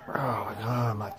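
barely getting words out; breathless whisper. Real, not acted. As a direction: voice failing, breathy and faint. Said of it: "It's hard to talk after".

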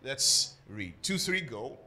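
ordinary talk, nothing marked

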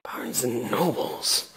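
slow, low, soft puzzlement; baffled questioning. Retold or imitated. Baffled customer repeating the guard's clue, slow puzzlement. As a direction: draw the name out quietly, puzzled. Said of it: "'cause now we're like".

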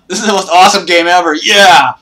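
fast, high and loud with excitement; fast excited shout; thrilled. Real, not acted. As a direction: gush with excitement. Exhilarated after surviving.

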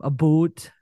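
about said as "a boot"; slow Canadian "a boot" for about; Canadian pronunciation. Shown as a demonstration. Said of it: "Yep, they say process".

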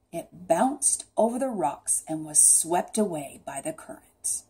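ordinary delivery, nothing marked

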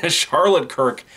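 high giggle into the name; helpless chuckle, then say the name through it; real laugh spilling into the words; laughing in disbelief. Real, not acted. Amused disbelief at bad acting.

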